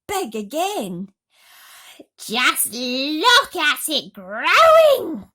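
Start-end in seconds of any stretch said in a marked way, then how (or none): 2.2-5.3 high, loud, slow shriek of wonder; shrill aunt crying out in amazement; Aunt Spiker crying out. A narrator's reading. Aunt marvels at the growing peach, crying out. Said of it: "Aunt Spiker cried".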